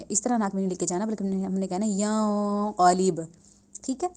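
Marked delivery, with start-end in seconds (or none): none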